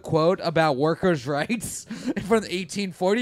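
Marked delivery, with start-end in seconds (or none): none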